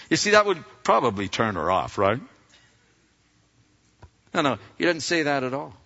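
ordinary talk, nothing marked

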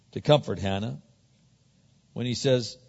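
ordinary delivery

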